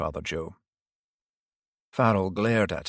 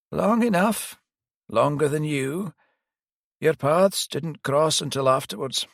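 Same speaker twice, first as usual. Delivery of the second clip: put-on higher old priest voice, rueful; elderly priest; 7 semitones higher, 3 dB louder than usual. Priest admitting he failed the detective, higher pitched. Priest confesses his past role.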